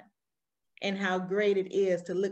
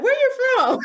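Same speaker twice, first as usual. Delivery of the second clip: high, loud laughing Southern "Where you from?"; mock a Southern local asking "Where you from?" with a laugh; Southern drawl question; 10 dB louder than usual. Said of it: "my country accent is thicker here".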